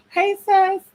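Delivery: high greeting. Real, not acted. Greeting her god sister.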